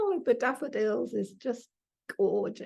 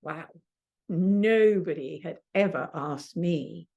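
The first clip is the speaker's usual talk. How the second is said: ordinary delivery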